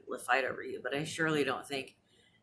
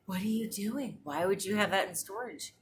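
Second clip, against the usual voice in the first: mock disbelief; 5 semitones higher than usual. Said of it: "and I was like".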